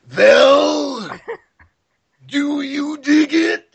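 high, loud, slow Macho Man yell; Macho Man impression. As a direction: growl it out like Randy Savage proposing. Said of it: "and I said".

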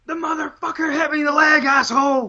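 high-pitched mock outburst at ordinary volume; Psycho Sid impression. Limping wrestler caught on camera, imitated cursing outburst. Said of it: "the exact quote is".

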